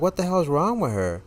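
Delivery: high-pitched retold exasperation; exasperated disbelief. Retelling exasperation at waitress.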